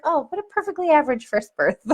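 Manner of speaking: light and matter-of-fact, a touch amused; chirpy nurse, lightly voiced; breezy nurse. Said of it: "she was like".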